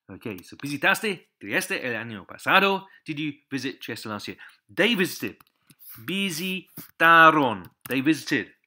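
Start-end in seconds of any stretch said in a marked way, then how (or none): 6.0-7.7 Visitaron slow, loud and higher; slow word model. Shown as a demonstration. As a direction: say Visitaron as vi-si-ta-ron, punching the end. Teacher models the word slowly.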